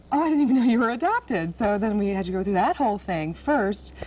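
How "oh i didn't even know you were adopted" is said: surprised friends. Retold or imitated. Friends' reaction to news.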